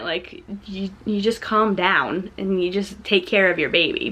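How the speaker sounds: ordinary delivery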